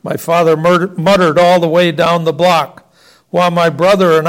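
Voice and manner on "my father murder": ordinary delivery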